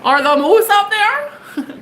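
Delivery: high, drawn-out mock Canadian; exaggerated Canadian accent. Reader joking about moose up north, exaggerated Canadian accent. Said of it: "God, it's so offensive".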